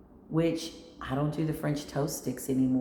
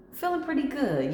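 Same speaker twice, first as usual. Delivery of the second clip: cheery; 10 semitones higher than usual. Retold or imitated. Answering herself in self check-in.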